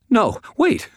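very high, frightened yelp; high-pitched pleading cry; panicked squeak. A narrator's reading. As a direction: squeak it out in terror. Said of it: "Evelyn squeaked".

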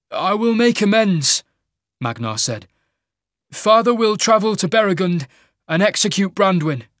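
high, loud, dutiful declaration; young king Magnar. Acted. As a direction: pledge obedience to the mage lord.

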